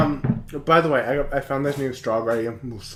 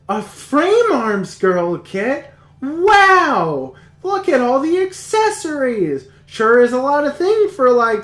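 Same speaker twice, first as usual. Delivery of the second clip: high, excited cutesy schoolgirl; anime girl voice; 9 semitones higher, 4 dB louder, slower than usual. Retold or imitated. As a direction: gush like a bubbly anime heroine. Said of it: "the show starts out and it's like".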